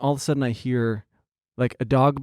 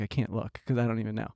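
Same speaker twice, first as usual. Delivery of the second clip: muttered to yourself, much softer; inner thought; 6 semitones lower, 10 dB quieter than usual. Retold or imitated. A private worry while staring at the dog owner, softly. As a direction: say it under your breath, unsure. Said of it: "in my head, I was like".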